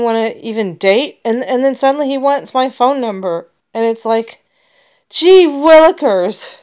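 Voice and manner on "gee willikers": sarcastic exclamation. Real, not acted. Sarcastic exclamation about his request.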